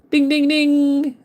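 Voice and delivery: high, drawn-out ringing dings; sing-song bell noise; imitated bell. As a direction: chime it like a little bell.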